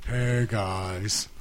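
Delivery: deep pitch shifted. Retold or imitated. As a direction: growl out a slow monster hello. Copies the greeting in Crom voice.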